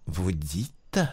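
slow, soft, halting disbelief; hushed and stunned, dragging the words; stammering in disbelief. A narrator's reading. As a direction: barely get the words out, stunned. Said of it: "Il balbutia enfin".

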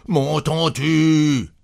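high, stretched-out angry cry; trembling fury. A narrator's reading. Husband shaking his wife, furious repeated demand. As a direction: drag the words out in rage. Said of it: "Il tremblait de fureur".

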